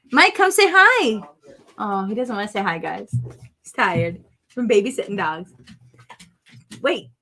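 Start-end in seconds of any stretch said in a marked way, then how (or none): 0.1-1.2 high, loud call across the room. Real, not acted. Wife calls husband to greet viewers, calling out. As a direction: call your husband over.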